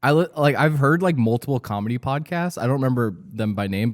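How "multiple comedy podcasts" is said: normal talking voice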